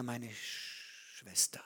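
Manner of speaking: geflüstert und tiefer, lauernd; flüstere es wie ein Bedränger; bedrohliches Flüstern. Acted. Amnon bedrängt Tamar. (leises, drohendes Flüstern)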